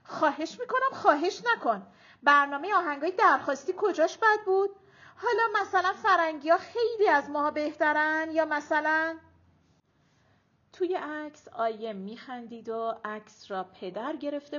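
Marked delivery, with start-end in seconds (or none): 0.0-9.2 loud, high, indignant retort; bristling. A narrator's reading. Grandmother bristling at Arezu's plea, loud and indignant. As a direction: snap back and rattle off complaints loudly. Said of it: "ماه منیر براق شد".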